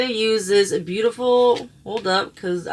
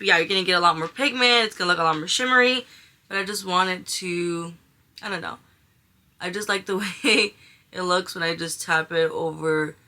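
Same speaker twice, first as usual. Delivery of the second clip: ordinary talk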